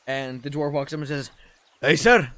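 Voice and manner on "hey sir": loud soldier's answer; eager reporting. Acted. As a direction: sound off to your commanding officer. Dwarf reporting to his commander.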